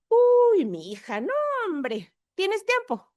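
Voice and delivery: high, eager gossipy aunt voice. Retold or imitated. Aunt delighted her niece wants the family story, high and eager. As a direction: play the aunt thrilled to have a story to tell.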